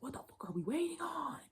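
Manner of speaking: high whisper of impatience; whispered quote. Retold or imitated. Reader channeling Cancer's impatience, high whisper. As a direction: breathe it out like an echo in your head. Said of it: "I just keep hearing".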